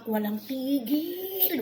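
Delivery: very slow, high, stretched TIGIL; drawn-out emphasis. Real, not acted. Vlogger fed up with endless nagging, stretching "Walang tigil" out high. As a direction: drag out "tigil" as if it never ends.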